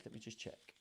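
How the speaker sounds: quick whisper to herself; whispered aside. Real, not acted. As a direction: whisper while checking the page. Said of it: "have I missed a page".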